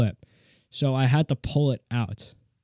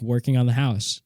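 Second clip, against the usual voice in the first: ordinary talk, nothing marked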